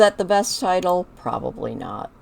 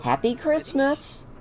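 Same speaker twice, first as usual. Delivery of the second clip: cheery gift giving; 7 semitones higher than usual. Acted. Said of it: "She's like".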